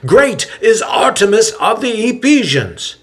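high, loud crowd cry; high loud cry to Artemis. A narrator's reading. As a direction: call it out to the whole street. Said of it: "the men cry out".

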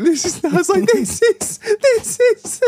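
very high, squeezed stammer; helpless with laughter. Retold or imitated. Showing him the mud, too helpless with laughter to speak. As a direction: try to point it out but fail to get words out. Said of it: "no one can get any words out 'cause we're all laughing".

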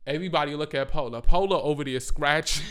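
normal talking voice

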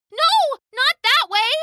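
very high, loud scream; frightened scream. Acted. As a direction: scream to stop it. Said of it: "she screamed".

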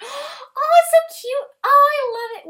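very high loud fangirl squeal; excited squeal. Real, not acted. Cute Toga art.

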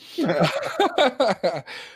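hearty burst of real laughter. Real, not acted. Host amused by co-host's idea, laughing. Laughs after giving in to joke.

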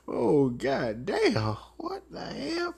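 mock amazement. Imitated reaction.

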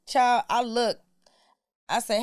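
ordinary delivery

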